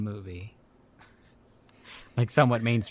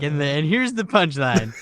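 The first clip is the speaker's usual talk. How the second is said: slow, louder showman build-up; slow, louder mock showman voice; slow mock announcement; 5 semitones higher, 6 dB louder, slower than usual. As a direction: announce the punchline slowly like a host. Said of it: "He's like".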